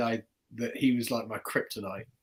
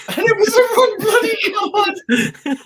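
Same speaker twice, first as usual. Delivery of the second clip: much higher, louder, slow laughing cry on "wrong bloody card"; laughing exasperated shout; 10 dB louder, slower than usual. Real, not acted. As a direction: laugh in disbelief at your own failure. Laughing recalling the failed trick.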